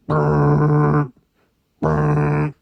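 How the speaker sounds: buzzing imitation of a jet cannon; gun sound brrr. Praising the A-10 Warthog with its gun sound.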